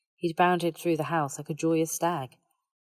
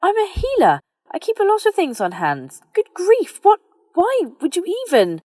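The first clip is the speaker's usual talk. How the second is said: much higher and louder, tumbling protest; flustered outburst; 12 dB louder than usual. Acted. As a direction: splutter in embarrassed outrage. Hermione flustered denying Snape rumour.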